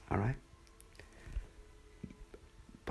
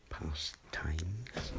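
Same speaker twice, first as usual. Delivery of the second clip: whisper; 3 semitones lower, 8 dB quieter than usual. Real, not acted. Whispered aside.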